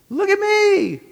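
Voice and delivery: high, loud attention-seeking squeal; mock show-off. Contrasts Christ with self-promotion.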